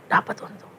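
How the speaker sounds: a hushed greedy echo; whispered echo. Acted. (bisikan serakah)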